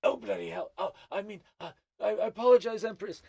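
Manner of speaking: high, loud gnome stammering an apology; startled gnome. Acted. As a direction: blurt in alarm, then backpedal before the Empress. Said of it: "looks up and sees you and goes".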